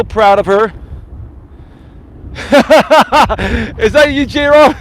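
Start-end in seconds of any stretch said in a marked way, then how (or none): 2.3-4.7 can't stop laughing, high and loud; cracking up at own show; real laughter. Real, not acted. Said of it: "I can't stop laughing".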